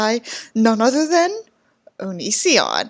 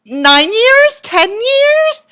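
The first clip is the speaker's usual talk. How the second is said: mock disbelief; 8 semitones higher, slower than usual. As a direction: pitch climbing higher with each question. Mocking disbelief, pitch climbing.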